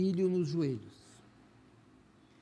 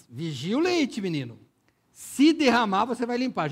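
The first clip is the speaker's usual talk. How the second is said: mother scolding; 3 semitones higher, 5 dB louder than usual. Retold or imitated. Mother scolding child about milk.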